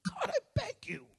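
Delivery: whispered desperate plea; begging whisper. Acted. Paul pleading with God, whispered begging. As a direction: breathe it out like a begging prayer.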